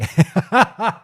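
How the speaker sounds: sudden high burst of laughter. Real, not acted. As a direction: laugh out loud, high and bright. Laughing at own jab at Hunk.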